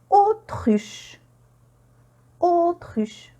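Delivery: Autruche with a drawn-out "o" opening; AU pronounced O. Shown as a demonstration. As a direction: model Autruche, AU as one "o". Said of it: "mais on prononce O". (Autruche, « au » prononcé « o »)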